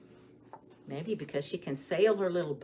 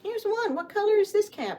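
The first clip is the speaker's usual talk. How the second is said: excited question; 14 semitones higher, 7 dB louder, faster than usual. Acted. Teacher quizzing children.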